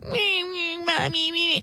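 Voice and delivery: high, whimpering mock cry; imitating a crying boy. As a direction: whine like a bratty little boy. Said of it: "And he was like".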